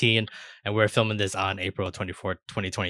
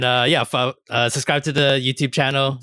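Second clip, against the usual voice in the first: ordinary talk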